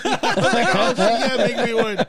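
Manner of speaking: high, slow, laughing "oh shit yeah"; excited reply. Retold or imitated. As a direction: act thrilled at the offer. Retold own excited reply.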